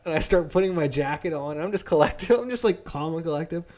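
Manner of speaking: ordinary delivery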